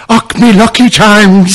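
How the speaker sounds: slow, loud, high leprechaun Irish; mock Irish brogue, drawn out; hammed-up Irish accent. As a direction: ham up a stage-Irish leprechaun. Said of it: "I was doing Irish".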